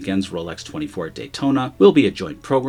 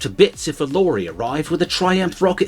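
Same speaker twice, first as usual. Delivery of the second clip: put-on British accent; 6 semitones higher than usual. Shout out to UK brothers.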